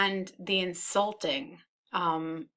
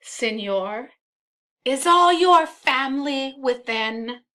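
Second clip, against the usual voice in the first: raised, higher calling voice; yelling; 6 semitones higher, 7 dB louder than usual. Acted. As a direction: call up to the window, swelling louder. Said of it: "Rodrigo yells".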